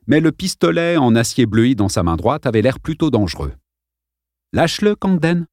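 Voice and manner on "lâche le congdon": higher, steady command to let go; a calm boy giving a firm order; firm repeat. A narrator's reading. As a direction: repeat the order firmly, no shouting. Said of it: "répéta-t-il".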